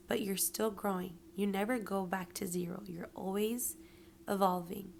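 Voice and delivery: usual speaking voice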